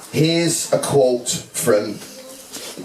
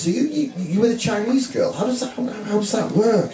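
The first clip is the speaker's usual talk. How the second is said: higher-pitched mock of nosy askers; incredulous question, voiced high; puzzled questioning; 8 semitones higher than usual. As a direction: lightly imitate people asking in bafflement.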